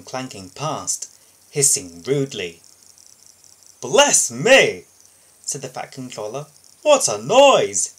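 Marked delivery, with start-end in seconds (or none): 3.8-7.9 much louder startled outburst, slow on the noise; startled exclamation. Acted. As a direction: react to the loud hissing. Boss startled by hissing.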